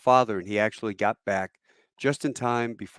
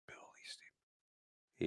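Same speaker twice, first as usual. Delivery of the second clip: slow, barely audible whisper; hushed echo of a name just heard; stunned whisper; 23 dB quieter than usual. Retold or imitated. Stunned sergeant repeats the name.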